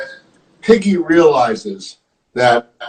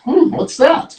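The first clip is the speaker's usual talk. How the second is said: higher-pitched, curious wondering; puzzled question; 12 semitones higher than usual. Acted. Storyteller voices Elephant looking over at the cowboy, puzzled. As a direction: wonder aloud at a strange sight. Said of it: "with a question mark".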